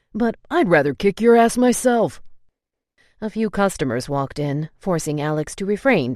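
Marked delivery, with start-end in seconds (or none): 0.1-2.2 louder, higher furious threat; angry threat. A narrator's reading. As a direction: explode at him. Alex threatening, voice rises.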